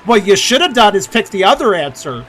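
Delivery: high, loud mock tutting; mocking scold. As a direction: voice the game as a smug scold. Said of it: "I like how the game keeps going".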